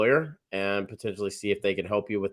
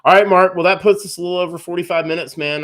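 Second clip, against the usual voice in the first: energetic wrap-up; 8 semitones higher, 8 dB louder, faster than usual. Real, not acted. Host wrapping up the stream.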